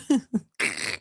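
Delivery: short laugh of disbelief; real short chuckle; amused laugh. Real, not acted. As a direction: laugh it off, incredulous. Reacting to the played clip.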